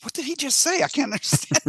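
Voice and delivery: high-pitched mock bewilderment; imitated exasperated confusion; baffled imitation. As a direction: squeak it like a confused viewer. Baffled couple watching film.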